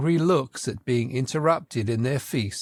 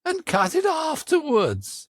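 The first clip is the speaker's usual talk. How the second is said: the unicorn ordering; 11 semitones higher, slower than usual. A narrator's reading. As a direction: bark the order high and slow like the unicorn. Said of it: "the unicorn remarked".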